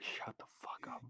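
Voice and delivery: whispered mock put-down; whispered retort. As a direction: hiss it under your breath. Firefighters' imagined reply.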